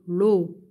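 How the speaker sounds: L first, then O, blended as "lo"; syllable "lo". Shown as a demonstration. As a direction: sound out L-O as one syllable, "lo". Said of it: "L O". (syllabe « lo », le L avant le O)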